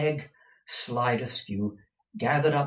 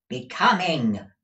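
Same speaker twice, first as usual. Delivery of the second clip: raised, loud, falling answer; emphatic repeat; 6 semitones higher, 8 dB louder than usual. A narrator's reading. Gride repeating a word for Peg, loud emphasis. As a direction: repeat it firmly for the deaf. Repeating louder for deaf listener.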